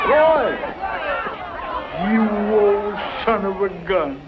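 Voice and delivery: loud, delighted greeting; surprised delight. Acted. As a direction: shout out his name with glad surprise.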